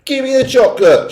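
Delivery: put-on high, loud boy's shout; shouted demand. A narrator's reading. Boy demanding his chocolate, loud and high. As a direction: yell for the chocolate. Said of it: "shouted Bruno".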